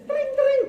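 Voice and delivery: playful phone ring sound; phone ring imitation. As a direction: mimic a ringing phone.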